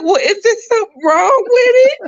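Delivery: high, loud mock-wailing hurt mother. Mom retelling her hurt at the rejection, high hammed-up wail. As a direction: whine it like a wounded mother.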